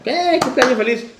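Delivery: put-on high-pitched birthday kid cheer; excited little kid. Kid at his birthday cake, imitated as a high, excited cheer. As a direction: mimic a child's birthday singsong cheer. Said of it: "el carajillo está".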